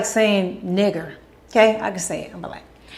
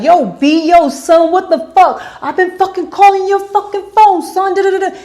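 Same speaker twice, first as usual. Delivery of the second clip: loud, high, rapid-fire New York street cussing; imitating China cussing; 10 semitones higher, 8 dB louder than usual. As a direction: blast it like a furious friend storming in. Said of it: "She walked into the house cussing me out".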